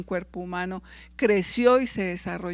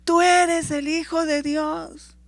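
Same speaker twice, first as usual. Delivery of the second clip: slow, loud cry pitched much higher; demons shouting; 8 semitones higher, 6 dB louder, slower than usual. A narrator's reading. Preacher voicing spirits crying out to Jesus, loud slow shout. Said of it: "caían al suelo y gritaban".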